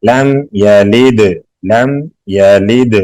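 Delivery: slow recitation of "لم یلد" with a smooth m; soft meem. Shown as a demonstration. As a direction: hum the m in "لم" without bounce. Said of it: "نرمی سے پڑھیں گے".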